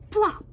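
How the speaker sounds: loud, high sound-effect word; sudden plop. A narrator's reading. Narrator tells of something hitting the tea, sudden plop. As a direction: pop it out suddenly.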